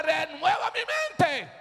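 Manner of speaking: drawn-out preacher's shout; rallying cry. Real, not acted. Preacher leading the church's prayer, crying out. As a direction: cry it out for all to repeat.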